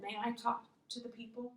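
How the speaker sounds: soft, polite asking voice; quiet request. Acted. Paul asking the leader to address the crowd, quiet request. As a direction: ask permission quietly and gently. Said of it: "Paul quietly said".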